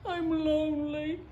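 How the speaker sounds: a forlorn storybook confession; lonely little beaver. Acted. Little Beaver to the voice across the pond, lonely and plaintive. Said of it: "Little Beaver thought for a moment".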